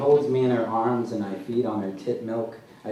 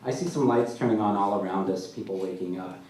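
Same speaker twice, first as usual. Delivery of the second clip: ordinary delivery, nothing marked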